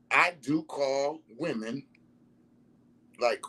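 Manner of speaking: ordinary talk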